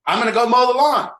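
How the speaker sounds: mock eager husband. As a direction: announce it brightly so everyone hears. Husband announces chore to look good.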